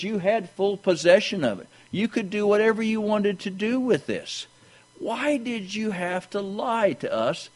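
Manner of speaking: high-pitched, softening reproach; high, hushed preacher's appeal; remonstrating. Acted. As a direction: pitch it high as you question their lie. Voices Peter questioning Ananias.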